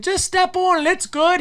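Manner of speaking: high, carefree call over the distance; calling back. Retold or imitated. Answering the men on the bank from the ice, high carefree call. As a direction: shout back breezily.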